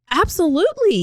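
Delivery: high, loud, eager agreement; imitated super excitement. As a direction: burst out with mock-thrilled enthusiasm. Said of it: "So he's super excited".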